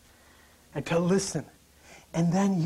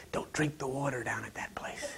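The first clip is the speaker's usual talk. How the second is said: soft whispered family warning; 5 semitones lower, 9 dB quieter than usual. Retold or imitated. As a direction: whisper it like a fretful relative.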